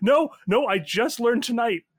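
high, loud panicked protest; acted panic, pleading innocence; freaking out. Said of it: "[name] is fully freaking out".

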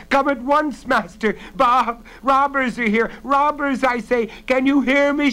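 high, loud cries of alarm; urgent full-voiced calling; loud shout. A narrator's reading. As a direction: yell to rouse the sleeping house. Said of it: "shouted again loudly as she could".

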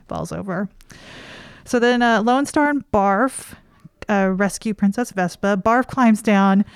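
normal talking voice

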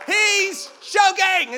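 slow, high, loud shout of alarm; retold alarm shout. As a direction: call out for help, high and drawn out. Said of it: "Somebody said".

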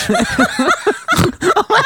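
high shrieking cackle; witch cackle. Real, not acted. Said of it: "Sound like a Stygian witch".

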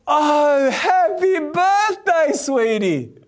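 slow, high, strained "happy birthday"; belated greeting, caught out; panicked realisation. Retold or imitated. Sudden realisation, sheepish greeting.

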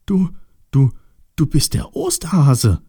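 halting, then high astonished exclamation; amazed. Acted. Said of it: "staunte Osterschweinchen".

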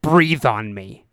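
low, drawn-out order; retold cop giving a slow, deliberate order; slow cop command. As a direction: say it low and very slowly. Cop orders breath test, first time.

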